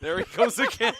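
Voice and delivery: loud laughing tease. Real, not acted. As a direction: crack up while teasing. Teasing co-host who yawns.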